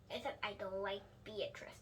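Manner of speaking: breathy whisper, secret aside. A narrator's reading. Junie confides to the reader in a whisper. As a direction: whisper it like a small confession.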